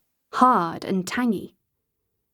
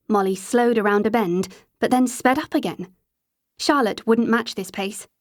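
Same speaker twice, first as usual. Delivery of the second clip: usual speaking voice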